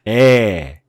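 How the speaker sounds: 에 from the belly, low in the throat; 에 said dark and deep from the chest; low dark A vowel. Shown as a demonstration. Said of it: "A 같은 경우는". (배에 힘을 준 어두운 에)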